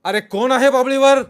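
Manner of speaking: high, very loud demand; insistent shouted question. A narrator's reading. Rama asks a third time who is up there, insistent shout. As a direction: yell it as if ignored. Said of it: "रामाने तिसऱ्यांदा विचारले".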